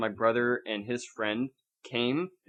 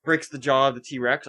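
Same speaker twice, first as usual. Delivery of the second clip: ordinary talk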